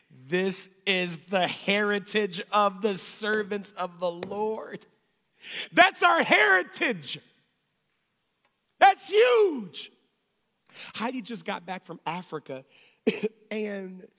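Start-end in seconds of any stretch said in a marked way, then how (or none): 0.1-9.9 slow, weighty scripture building to a shout; emphatic preaching. Acted.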